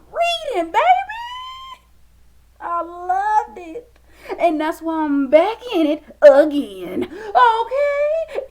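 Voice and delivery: laughing through tears. Real, not acted. Gushing about loving reading.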